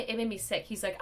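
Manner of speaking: normal talking voice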